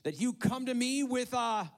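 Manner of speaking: loud, high-pitched roared challenge. A narrator's reading. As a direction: keep roaring, high and loud. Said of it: "he roared at David".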